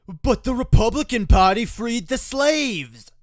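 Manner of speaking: loud high mocking of "But the Republican Party freed the slaves"; mocking quote. Host mocks right-wing argument with a loud sneering quote. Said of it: "dishonest right-wing argument".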